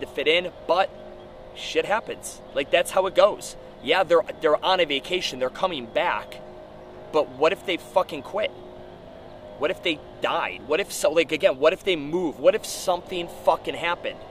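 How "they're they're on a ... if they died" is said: ordinary delivery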